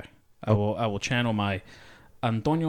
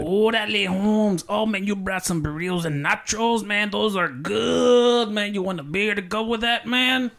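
put-on Mexican accent; 12 semitones higher, 3 dB louder, slower than usual. Actor hosting his friend in a put-on Mexican accent. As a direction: play it as a Mexican homeboy. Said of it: "Sounded too Canadian to me".

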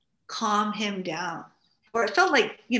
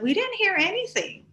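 put-on a higher-pitched, innocent kid voice; mimicking the kids; 10 semitones higher than usual. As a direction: toss it off like a puzzled teenager. Niece and nephew quoted.

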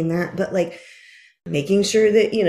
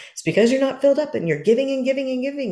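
fast, higher-pitched voice of a lecturing friend; imitating Amy; 7 semitones higher, faster than usual. Friend Amy scolding her about overgiving, fast and high. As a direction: rattle it off like a concerned friend scolding. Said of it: "Amy will go".

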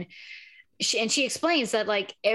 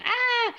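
short high-pitched mock scream; scared girl scream. Imitating an actress's outtake, mock scared scream. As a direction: imitate a frightened girl's shriek. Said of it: "scared girl scream".

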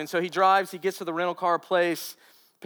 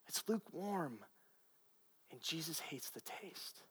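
hushed; 16 dB quieter than usual. Real, not acted. Preacher drops to a hush.

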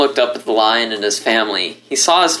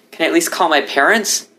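pleading; 7 semitones higher than usual. Acted. Begging to call parents.

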